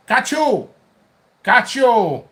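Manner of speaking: put-on high, loud Korean-accent exclamation; mock Korean accent. Acting out the sauce's name, mock Korean accent.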